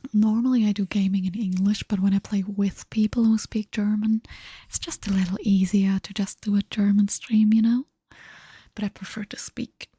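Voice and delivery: normal talking voice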